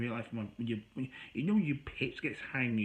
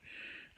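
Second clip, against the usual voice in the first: soft breathy sigh of embarrassment; embarrassed sigh; 13 dB quieter than usual. Retold or imitated. Reliving being caught by the neighbor, a soft sigh. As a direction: let out a quiet embarrassed sigh. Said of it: "And I was like".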